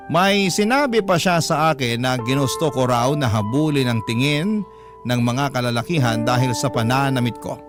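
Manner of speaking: normal talking voice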